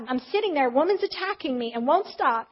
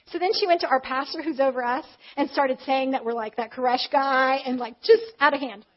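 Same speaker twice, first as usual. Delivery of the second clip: ordinary talk, nothing marked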